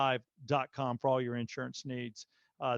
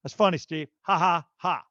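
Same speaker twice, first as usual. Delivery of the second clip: sarcastic fake laugh; 5 dB louder than usual. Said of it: "I'm like".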